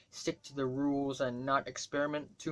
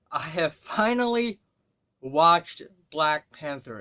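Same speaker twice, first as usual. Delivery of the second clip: louder and slowed, leaning hard on watched; big announcement; 6 dB louder than usual. Real, not acted. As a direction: proclaim it like long-awaited news.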